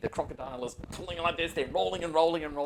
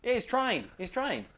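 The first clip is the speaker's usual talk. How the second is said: higher, louder easygoing Aussie ranger giving a tour; 11 semitones higher, 9 dB louder than usual. Acted. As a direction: play the laid-back outback ranger showing the spot. Ranger urges players to pet.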